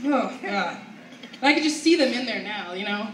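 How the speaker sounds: normal talking voice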